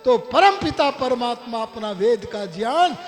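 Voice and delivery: excited shout. Acted. Disciple shouting his sudden realization to his guru, excited and loud. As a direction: shout it out as the truth finally lands. Disciple leaps up, finally understanding.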